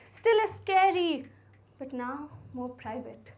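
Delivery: poem recitation. Acted. Recites own poem after introduction.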